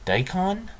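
Daikon said high with a questioning rise; unsure pronunciation. Real, not acted. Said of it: "Is that how you pronounce that?".

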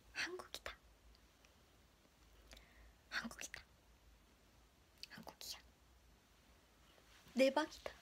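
breathy whispered exclamation. Real, not acted. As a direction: whisper so as not to wake anyone. Whispering near sleeping dog. (속삭이며)

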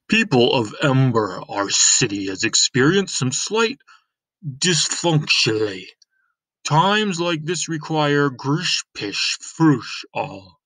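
lightly voiced mayor addressing the crowd; a hint of a self-important official; pompous mayor. Mayor addressing the citizens of Ember, lightly pompous.

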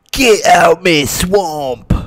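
loud, drawn-out put-on ogre yell; Shrek impression. Shown as a demonstration. Gamer showing teammates a rehearsed movie impression, Shrek yell. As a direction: bellow it slow and high as Shrek. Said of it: "I've got an impression I've been working on".

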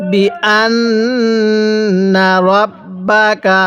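very slow, drawn-out syllables; model recitation. Shown as a demonstration. Teacher models Quran words for learners, slow measured recitation. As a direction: stretch each long vowel evenly. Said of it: "আমার সাথে পড়বেন".

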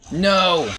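high loud panicked yell. Real, not acted. Game danger.

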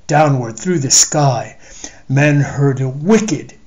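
ordinary delivery, nothing marked

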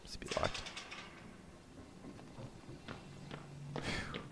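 hushed whisper while sneaking. Real, not acted. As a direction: whisper it under breath. Sneaking past enemy.

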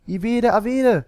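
put-on high, loud child-like shout; children shouting. A narrator's reading. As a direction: shout your choice like an eager child. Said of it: "schrien einige".

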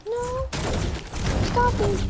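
high squeaky threat with giggles; high-pitched playful menace; high Elmo-like voice. Pretend. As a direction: gloat in a puppet voice. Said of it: "Do I sound like Elmo?".

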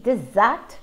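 "dessert" said de-ZERT, second syllable stressed, drawn out slowly. Shown as a demonstration. Teacher contrasting desert for students, stressing the second syllable. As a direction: lean on the end of "dessert", slowly. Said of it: "the second syllable is the stressed syllable".

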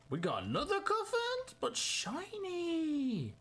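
delighted high-pitched reveal; excited shiny find. Real, not acted. Finds a shiny card.

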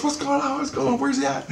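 very high, hurried questions; anxious friend. Retold or imitated. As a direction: ask fast like you've just run in. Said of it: "he comes running over and he goes".

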